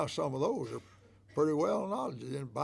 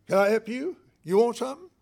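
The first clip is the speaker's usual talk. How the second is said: curt, louder, higher snap at a customer; rude clerk; 7 semitones higher, 8 dB louder, faster than usual. Shown as a demonstration. Preacher demonstrates a rude store clerk, curt and loud. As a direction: bark it like a rude shop clerk. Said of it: "You see the difference?".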